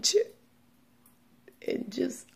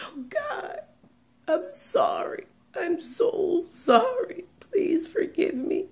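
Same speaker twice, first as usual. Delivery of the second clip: much higher, crying, whispered begging, slow; crying plea; 8 semitones higher, slower than usual. Real, not acted. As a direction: weep it out as you beg forgiveness. Retelling begging God in hell.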